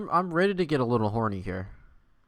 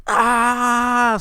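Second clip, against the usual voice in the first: high, loud scream of horror; horrified scream; 11 semitones higher, 13 dB louder than usual. Acted. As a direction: shriek as if your treasure just vanished. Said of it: "screamed Scrat in horror".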